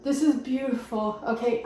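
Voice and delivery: ordinary delivery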